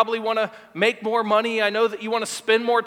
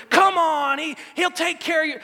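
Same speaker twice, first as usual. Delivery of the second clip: hype man; 6 semitones higher than usual. Retold or imitated. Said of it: "his hype man".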